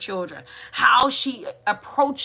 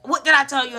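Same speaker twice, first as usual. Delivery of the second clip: mock parental scolding, pitched up; loud and direct; 6 semitones higher, 4 dB louder, faster than usual. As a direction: imitate a direct, no-nonsense telling-off. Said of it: "it can be very loud and direct".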